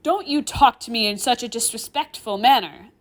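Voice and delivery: loud, indignant scolding. Acted. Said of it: "I raise my voice".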